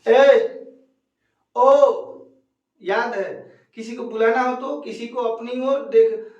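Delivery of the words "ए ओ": calling out. Shown as a demonstration. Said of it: "किसी को बुलाने के लिए".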